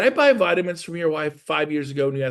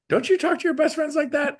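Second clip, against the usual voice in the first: much higher, teasing disbelief; mock disbelief; 13 semitones higher than usual. Real, not acted. To the audience about best friends, much higher mock disbelief. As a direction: act amazed they don't.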